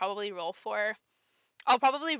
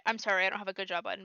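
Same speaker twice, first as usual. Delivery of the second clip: ordinary talk, nothing marked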